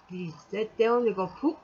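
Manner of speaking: ordinary talk, nothing marked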